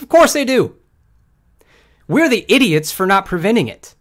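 loud and high, exasperated; emphatic outburst. Real, not acted. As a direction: hammer it home, fed up. Exasperated rant.

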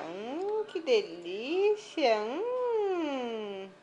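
high, loud hammed-up yum; mock delight. Real, not acted. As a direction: oversell every bite to get her eating. Hams up tasting food for baby.

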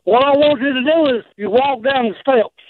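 usual speaking voice

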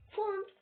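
Font with a rounded u, like fund. Shown as a demonstration. Showing friends how to read font, as fund. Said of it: "şöyle okunuyor".

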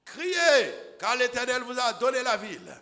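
rally the people with a cry; Joshua's command shouted. Acted. Joshua ordering the people to shout, full roar. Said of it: "Josué ordonna au peuple".

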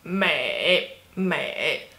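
美 low and dipping, in third tone. Shown as a demonstration. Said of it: "Third tone".

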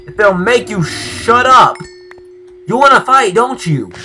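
high, loud threatening yell. Real, not acted. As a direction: shout threats at the mob. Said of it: "I'm yelling at a mo".

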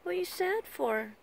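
high coaxing voice to a sulking pet. Real, not acted. Owner coaxing her sulking pet after swimming, high sing-song baby talk.

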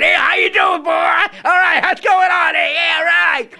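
very high hollering hillbilly voice with laughter; shouting hillbilly gang. Retold or imitated. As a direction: whoop it out high, cackling as you go. Hillbillies pile in waving.

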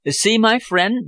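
a touch of delighted exclaiming. A narrator's reading. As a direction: call out with mild excitement. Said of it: "Bellamy exclaimed".

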